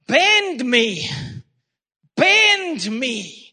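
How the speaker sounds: impassioned drawn-out cry, falling away. Retold or imitated. Retelling a revivalist's prayer, crying out. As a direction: cry it out like a plea to God. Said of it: "I cried out".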